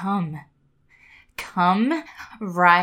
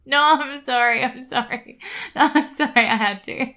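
laughing; 5 semitones higher, 5 dB louder than usual. Real, not acted. Laughing apology.

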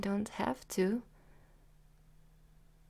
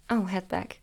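normal talking voice

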